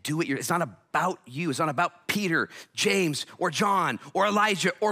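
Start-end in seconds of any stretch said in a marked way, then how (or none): none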